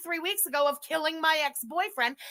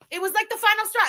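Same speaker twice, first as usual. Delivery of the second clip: loud, higher angry burst of disbelief; furious outburst; 9 semitones higher, 6 dB louder, faster than usual. Real, not acted. Streamer fed up with chat's praise, furious loud outburst. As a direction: blurt it out like you've finally snapped. Furious rant about chat.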